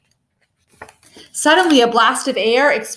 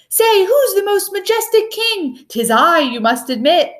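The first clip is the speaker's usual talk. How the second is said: high, pompous holler; 8 semitones higher than usual. A narrator's reading. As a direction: call out proudly to the square. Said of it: "He hollered from his window".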